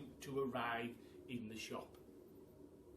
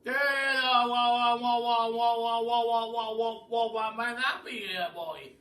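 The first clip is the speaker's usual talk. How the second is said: put-on loud, high, stuttering old duke; the Duke stammering kindly at Billy; 8 semitones higher, 13 dB louder than usual. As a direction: stammer wha-wha-wha like a flustered old gentleman. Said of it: "in his kindly, stammering voice".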